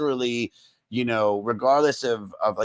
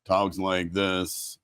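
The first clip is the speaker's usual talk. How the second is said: low, soft, very slow comic drawl; Stephen Wright; 5 semitones lower, 6 dB quieter, much slower than usual. Retold or imitated. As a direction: imitate a slow, subdued deadpan comic. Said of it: "he talks kind of".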